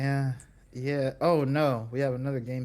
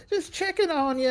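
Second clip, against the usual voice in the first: high, singsong phone voice; pitched-up mock mother; imitated checking-in mom; 13 semitones higher, 4 dB louder than usual. Voices mom's phone call.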